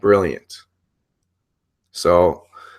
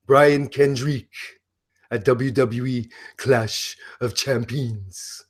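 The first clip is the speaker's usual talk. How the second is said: Broken Matt Hardy impression. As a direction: play the unhinged, grandiose wrestler announcing matches. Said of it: "the very complex character of Broken Matt Hardy".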